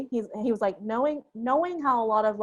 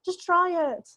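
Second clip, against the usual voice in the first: higher, urgent mock plea; pleading advice; 8 semitones higher than usual. Speaker urging young designers, a higher mock plea. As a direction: beg them to just try it. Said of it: "And it's just like".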